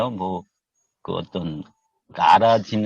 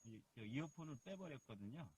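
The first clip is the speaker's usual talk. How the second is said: barely audible phone line; faint connection; 2 semitones higher, 30 dB quieter than usual. Real, not acted. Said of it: "안 들려, 안 들려". (멀리서 작게 들리는 통화 소리)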